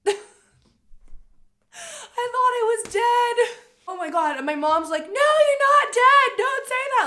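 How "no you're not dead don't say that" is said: a mother panicking as she insists her daughter is alive; panicked mom. Retold or imitated. Mom terrified by her daughter's question, very high and loud. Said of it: "Like, freaking out".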